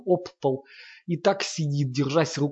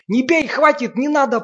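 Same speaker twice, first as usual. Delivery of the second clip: much higher, louder tearful begging; crying plea; 11 semitones higher, 7 dB louder than usual. A narrator's reading. Sasha begging Lomov to stop beating her, tearful plea. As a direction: beg him to stop, in tears. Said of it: "плачущим голосом просит она за дверью".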